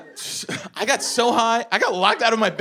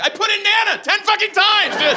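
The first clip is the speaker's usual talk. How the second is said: frustrated yell, much higher; mad outburst; 11 semitones higher than usual. Retold or imitated. High comic snapping at his friend, furious yell. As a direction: blow up at a helpful friend. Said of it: "so I got mad at him".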